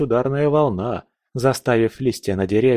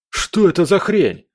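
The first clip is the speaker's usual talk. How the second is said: high, louder stunned question; shocked; 7 semitones higher, 4 dB louder than usual. Acted. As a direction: blurt it out in disbelief. Stunned gunner sees guns change. (в шоке)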